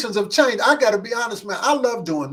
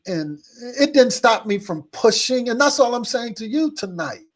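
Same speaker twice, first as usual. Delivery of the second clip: ordinary talk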